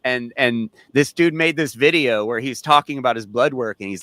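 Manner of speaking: ordinary talk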